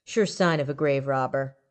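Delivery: plain reading voice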